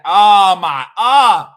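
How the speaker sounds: loud, high, slow exclamation; excited. Real, not acted. Reliving the fan's scream, loud and excited. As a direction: cry out in delight.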